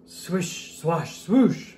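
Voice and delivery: breathy brushing swish; sweeping swoosh. Acted. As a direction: sweep the sounds out. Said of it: "went a big street sweeper".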